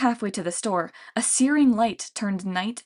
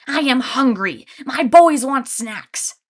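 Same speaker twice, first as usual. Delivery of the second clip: loud, high, slow monster voice; hungry Cyclops mother; 4 semitones higher, 8 dB louder, slower than usual. A narrator's reading. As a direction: demand food for her boys. Lady Cyclops whines hungry.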